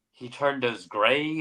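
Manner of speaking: usual speaking voice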